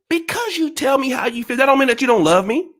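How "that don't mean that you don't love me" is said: ordinary talk, nothing marked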